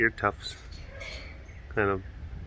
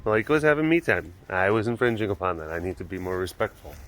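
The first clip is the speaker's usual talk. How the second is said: ordinary delivery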